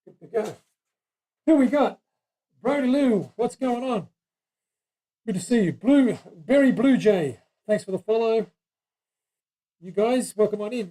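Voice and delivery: talking through a face mask; muffled voice behind a mask. Real, not acted. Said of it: "I've got a mask on my face. That's why I sound very, very funny".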